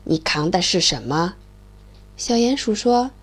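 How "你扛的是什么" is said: lightly surprised low frog voice; a hint of a puzzled frog. The frog asks the mole what he carries, low and surprised. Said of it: "青蛙很惊奇地说".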